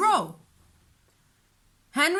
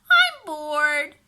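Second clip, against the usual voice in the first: put-on louder whiny child voice; bored little girl; 5 semitones higher, 8 dB louder than usual. A narrator's reading. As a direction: complain like a bored kid. Beatrice voiced by narrator.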